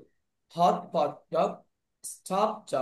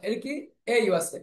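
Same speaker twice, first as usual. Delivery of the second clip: ordinary talk, nothing marked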